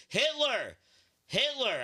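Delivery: loud, high blurted shout; shouted answer. Retold or imitated. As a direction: yell it out to heckle the emcee.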